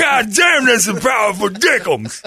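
high shouted crowing, stretched out at the end; boastful boyfriend impression. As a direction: marvel at yourself in a hammed-up holler. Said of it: "And he's like".

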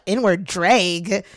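slow "drag" as DRAYG, questioning; questioned mispronunciation. Retold or imitated. People questioning Scott's word, "drag" echoed as DRAYG. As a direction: echo "drag" as a puzzled, drawn-out "drayg". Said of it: "people were like".

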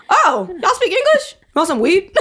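high, laughing dealer voice, surprised; mimicking street dealer. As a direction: ham up the weed seller spotting English speakers. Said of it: "talking like that".

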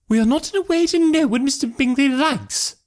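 very high-pitched, resentful mother voice; put-on high voice, full of resentment. A narrator's reading. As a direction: voice an indignant mother, pitched right up. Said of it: "said her mother resentfully".

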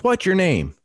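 high-pitched demanding holler; imitated shouted demand. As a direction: mimic the neighbour demanding an answer. Irate neighbour demands his name.

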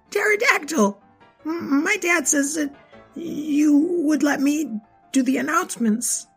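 put-on high hesitant little boy; shy child asking; nervous kid voice.

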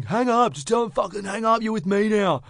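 high, insistent other guy; dude in background. Retold or imitated. Imitating a rival in the background of her call, high insistent voice. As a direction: cut in, high and bossy. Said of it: "this dude in the background going".

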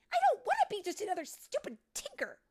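high, loud, fast rant; angry outburst. Acted. Tink lashing out at fellow tinkers, angry. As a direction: blurt it out in a temper.

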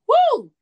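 high, loud celebratory woo; excited whoop. Real, not acted. Hyping up the room at the end, a loud excited whoop. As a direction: let out a whoop of delight.

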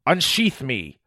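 shouted command. A narrator's reading. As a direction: order it like a living sword, without a full shout. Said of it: "a voice shouted in my mind".

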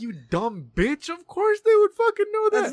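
slow insult climbing into a high, loud yell; acted incredulous shouting, pitch soaring; exasperated inner rant. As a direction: let the exasperation erupt on the second sentence. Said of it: "I was like, in my head".

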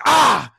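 high, loud answering shout; director's scream back. Retold or imitated. Director answering the dancer's scream, high loud shout. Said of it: "and screamed back at me".

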